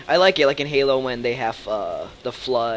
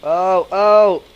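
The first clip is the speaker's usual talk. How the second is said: startled; 7 semitones higher, 10 dB louder than usual. Real, not acted. Enemy badass attacks in game.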